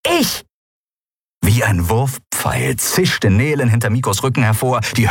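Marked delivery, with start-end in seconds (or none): 0.0-0.4 very high, sudden squeak; tiny defiant companion. Acted. Small winged companion announcing herself to the pirate, high cry. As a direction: pipe up suddenly.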